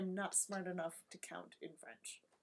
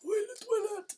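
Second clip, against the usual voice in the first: high, louder singsong; high mock call; 13 semitones higher, 5 dB louder than usual. Demonstrating a foreign word for toilet, high mock call. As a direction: say it high and drawn up in play.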